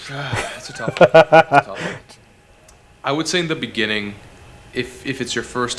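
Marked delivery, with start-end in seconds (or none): 0.1-1.1 breathy half-laughed under the breath; laughing whisper. Real, not acted. Hard question, sighs. 1.1-2.0 louder, higher, exclaimed; emphatic exclaim. Real, not acted. Hard question, repeats it.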